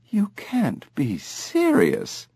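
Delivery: high, slow disbelief; mother scoffing at the son's news, drawn out high; high, slow, disbelieving; incredulous mother. A narrator's reading. Mother disbelieves her son.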